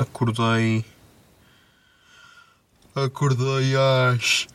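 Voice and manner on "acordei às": sleepy yawn through words. Real, not acted. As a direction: yawn it out, drowsy. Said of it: "ai, que sono".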